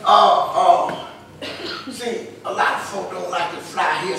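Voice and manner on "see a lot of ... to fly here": usual speaking voice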